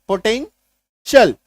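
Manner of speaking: Potential said slowly and clearly, falling at the end; slow model word. Shown as a demonstration. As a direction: draw out potential like a teacher. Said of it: "reading the spelled word".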